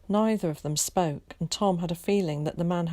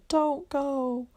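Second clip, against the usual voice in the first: put-on higher, a boy's cracked plea; desperate plea; 10 semitones higher than usual. A narrator's reading. Boy clinging to Tom's arm, pleading high and desperate. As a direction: beg him with the voice pitched up high. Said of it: "he pleaded".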